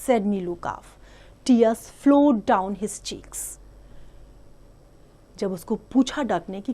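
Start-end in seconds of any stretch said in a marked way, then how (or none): 0.1-3.6 slow, deliberate read-aloud voice; story reading. A narrator's reading. Teacher reading an English story to the class, slow and clear. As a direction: read it out slowly for small children.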